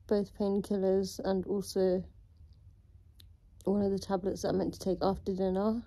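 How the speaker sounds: ordinary talk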